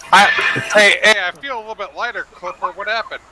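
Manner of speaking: high, fast puzzled surprise; suddenly confused and a bit alarmed; surprised. Acted. Character feels suit lighten.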